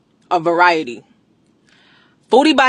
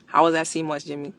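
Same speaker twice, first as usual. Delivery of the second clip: ordinary delivery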